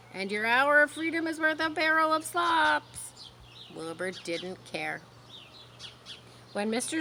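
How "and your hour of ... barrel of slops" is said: honking goose. A narrator's reading. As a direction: drag out the warning. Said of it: "honked the goose".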